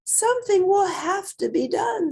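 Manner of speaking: high, loud, fed-up teacher voice; high-pitched teacher at her wits' end; exasperated teacher. A narrator's reading. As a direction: put your foot down, pitched high and loud.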